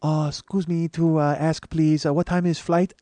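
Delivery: imitated Japanese businessman begging pardon; soft Japanese accent. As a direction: interrupt courteously in a halting Japanese accent. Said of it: "gonna do that Japanese voice".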